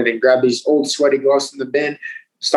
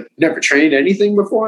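surprised; 6 semitones higher than usual. Retold or imitated. Coach amazed at untrained newcomer.

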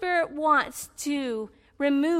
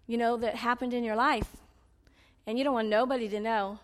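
ordinary talk, nothing marked

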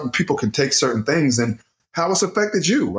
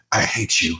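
mock mirror self-hate; 7 semitones lower than usual. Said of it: "talking to yourself in the mirror".

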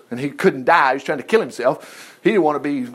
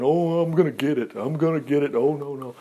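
mock worried thought; 3 semitones lower, 5 dB quieter than usual. All the time we're thinking.

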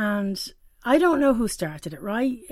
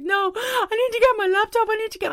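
tearful protest; 13 semitones higher, 4 dB louder than usual. Retold or imitated. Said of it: "I was like".

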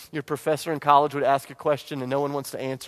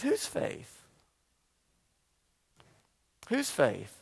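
breathy questioning whisper; 4 semitones higher, 7 dB quieter, much slower than usual. Acted. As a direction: lean in and whisper the question to the church.

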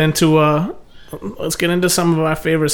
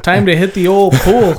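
slow, louder mock Canadian accent; cheerful mock Canadian voice; 4 semitones higher, 5 dB louder than usual. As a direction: ham up a Canadian accent, slowly. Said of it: "That's my best Canadian accent".

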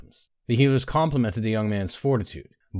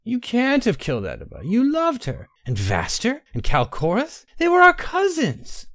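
distraught disbelief; 13 semitones higher than usual. A narrator's reading. Bainara desperately refusing to believe Tay killed them, much higher.

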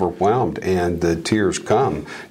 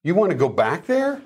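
disciples protesting; 8 semitones higher, faster than usual. Retold or imitated. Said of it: "his disciples said".

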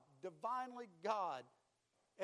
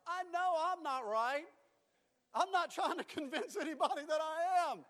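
raised, emphatic self-defense; loud protest; 6 semitones higher, 7 dB louder than usual. Retold or imitated. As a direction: declare it loudly to the whole room. Retold protest, preached loudly.

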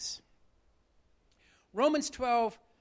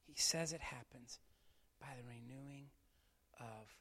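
soft, hushed sermon voice; hushed preaching; 7 semitones lower, 13 dB quieter than usual. Real, not acted. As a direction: draw them in low and quiet. Quiet closing teaching.

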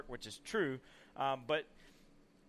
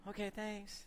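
small, soft, higher-pitched reply; timid, quiet; 4 semitones higher, 9 dB quieter than usual. Retold or imitated. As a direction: say it meekly, barely speaking up. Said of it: "just kinda heads off real quiet".

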